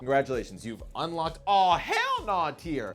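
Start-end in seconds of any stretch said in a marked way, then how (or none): 1.5-2.9 slow dramatic hell no. Acted. Repeats the reaction label.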